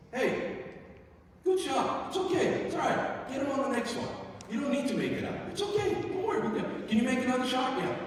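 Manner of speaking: high, upbeat reassurance; bright-pitched, supportive pep talk; encouraging coach. Shown as a demonstration. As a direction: cheer the kid on after a miss. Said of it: "just go".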